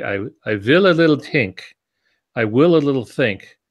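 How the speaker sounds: lightly imitating an Austrian German accent, thinking aloud; Einstein's Austrian German accent. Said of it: "deep, uh, Austrian German accent".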